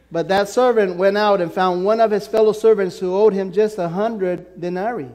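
usual speaking voice

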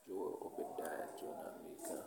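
muttered whisper while flipping pages; whispering to himself. Real, not acted. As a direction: whisper the book names under your breath. Preacher searching for Joel in Bible.

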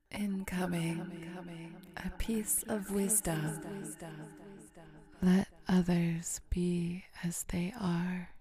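slow, soft pronouncement drenched in echo; echoing wisdom announcement. Pretend. Podcaster announcing a piece of wisdom, echoing and solemn. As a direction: intone it like a mock oracle, drawn out.

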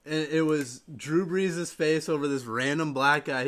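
usual speaking voice

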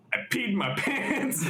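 high, slow, drawn-out words breaking into laughter; laughing mock confession. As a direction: stretch it out high while cracking up. Mimics Nick's voice text, cracking up.

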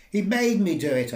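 loud, high outraged protest; indignant yell. Acted. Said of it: "I yelled indignantly".

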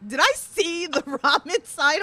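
laughing through the words, slowly; quoting Dante while cracking up; laughing quote. Retold or imitated. Telling friends Dante's question, laughing as she quotes. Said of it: "Dante said to me".

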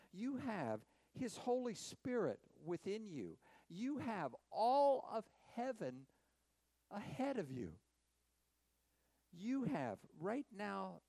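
rising, ringing preacher's litany; preaching at full force. Acted. Preacher to congregation, full ringing sermon voice. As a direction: build each line like a promise to the congregation.

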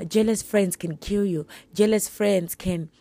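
ordinary talk

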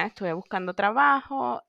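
normal talking voice

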